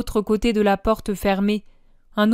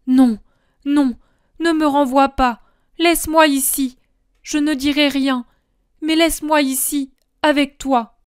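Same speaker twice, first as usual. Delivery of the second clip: higher, louder frightened pleading; pleading moan; 5 semitones higher, 5 dB louder than usual. Acted. John begging Jesus to let him stay, frightened plea. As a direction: beg him not to send you away. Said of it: "Jean a peur et gémit".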